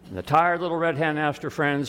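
usual speaking voice

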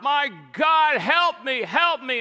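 high, loud cry for help; panicked yell. Acted. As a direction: yell it out as a desperate plea to heaven. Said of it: "the atheist yelled out".

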